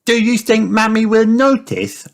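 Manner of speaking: put-on high-pitched child piglet voice; high, concerned child-character question; Peppa. A narrator's reading. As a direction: ask it as a little pig, very high.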